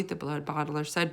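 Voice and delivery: ordinary delivery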